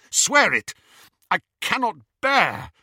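loud, intense demand; fierce demand. A narrator's reading.

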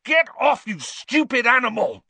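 loud, high furious yelling; outraged high shout; angry yell. A narrator's reading. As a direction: yell it at an attacking animal. Ron fights off attacking cat.

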